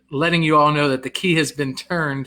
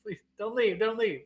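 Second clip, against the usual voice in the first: mock pleading; 7 semitones higher, 7 dB quieter than usual. Jokingly begs viewer to stay.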